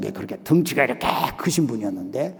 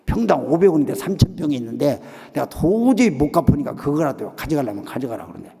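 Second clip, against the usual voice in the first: normal talking voice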